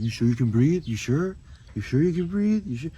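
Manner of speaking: mock the clerk's sneering question; rude guy mocking. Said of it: "The guy behind the counter was like".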